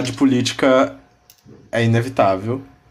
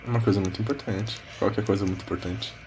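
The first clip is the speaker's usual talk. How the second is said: quiet echo; 4 semitones lower, 11 dB quieter, faster than usual. Pretend. Mocking echo, whispered aside.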